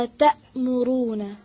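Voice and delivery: أتأمرون stretched slowly and said loud; careful model. Shown as a demonstration. Teacher repeating the word loudly for the student. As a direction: drag out أتأمرون syllable by syllable. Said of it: "फिर से पढ़ें".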